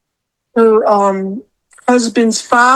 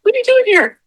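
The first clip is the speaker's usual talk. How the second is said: retold panicked yelling; 12 semitones higher than usual. Woman confronting a man at her blinds, high mock-panicked yelling. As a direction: re-enact frantic questions, pitched way up. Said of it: "I was yelling at him".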